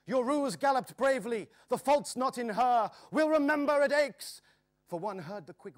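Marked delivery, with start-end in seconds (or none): 0.1-4.4 high, loud urgent shout; crying out. A narrator's reading. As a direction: call it out over the gallop. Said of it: "cried Joris".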